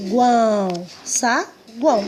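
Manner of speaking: teacher sounding out "saguão" by syllables; syllables separated. Shown as a demonstration. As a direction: stretch "Guão" and split "saguão". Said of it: "vamos separar aqui".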